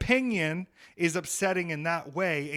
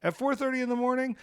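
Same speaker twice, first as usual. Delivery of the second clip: incredulous high mock voice; high mock question; 10 semitones higher than usual. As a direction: voice jumps way up, mocking. Mocking question, pitch jump.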